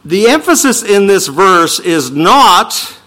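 normal talking voice

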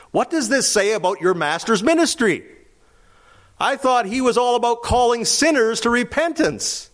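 high, pointed challenge; indignant critic. Acted. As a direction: needle the disciples, voice up high.

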